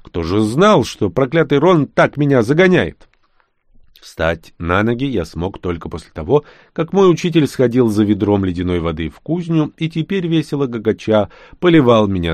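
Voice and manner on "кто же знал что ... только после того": usual speaking voice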